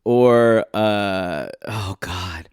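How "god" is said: ordinary talk, nothing marked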